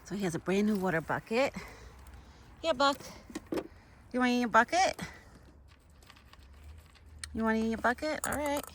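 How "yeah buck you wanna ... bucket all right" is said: pet talk. Real, not acted. Talking to her pet Buck.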